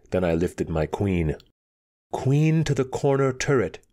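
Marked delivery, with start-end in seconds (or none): 2.1-3.8 higher-pitched, clearly spoken chess move; clear announcement. A narrator's reading. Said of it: "I said clearly".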